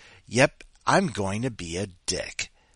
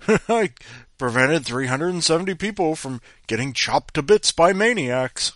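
boastful hero; 5 semitones higher, 6 dB louder than usual. Pretend. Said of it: "Instead of this".

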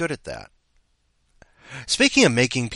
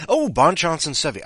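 ordinary talk, nothing marked

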